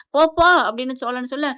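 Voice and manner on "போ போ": loud, higher "go, go" to drive someone off; shooing shout. Retold or imitated. Brother shooing the younger one away, loud. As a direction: bark them out the door.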